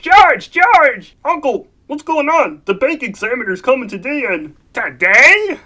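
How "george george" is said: loud urgent calling of George. Acted. Uncle calling George about the bank examiner, urgent shout. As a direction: call across the room, alarmed.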